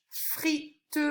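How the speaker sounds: "Frite" split in two as fri-te; teacher's syllable split of "Frite". Shown as a demonstration. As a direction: sound out "Frite" with the final e as its own syllable. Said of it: "on entend feu sur la première".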